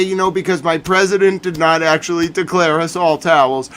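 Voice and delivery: ordinary talk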